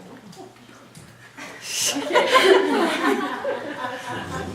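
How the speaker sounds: short nervous giggle; nervous laugh. Real, not acted. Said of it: "Sorry, I'm nervous".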